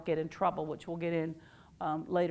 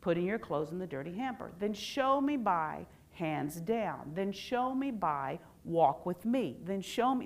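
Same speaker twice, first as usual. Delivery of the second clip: slow, deliberate parent reply; slow, calm demo; slower than usual. Shown as a demonstration. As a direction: lay out each Then show me slowly and evenly. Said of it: "Do you hear the difference?".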